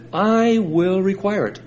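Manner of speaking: "I" stretched out high, then "will require it"; emphatic I. Shown as a demonstration. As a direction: lean long and high on the word "I". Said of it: "I" is emphatic".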